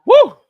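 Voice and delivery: very high, loud whoop; excited whoop. Real, not acted. Streamer celebrating good news, loud high whoop. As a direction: let out a big celebratory woo.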